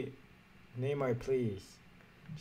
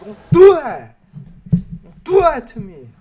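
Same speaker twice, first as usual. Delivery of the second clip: high, very loud begging shout; pleading yell; 8 semitones higher, 18 dB louder than usual. Real, not acted. Gamer begging the pack not to be bad, pleading yell. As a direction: yell the plea at the screen.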